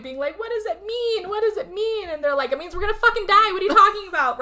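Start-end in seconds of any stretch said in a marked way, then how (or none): 0.4-2.1 high wailing question, imitated; mock frantic crying. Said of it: "she's frantically crying at this point". 2.5-4.4 high, fast, loud retort; mock exasperated outburst. Retold men snapping at her panic.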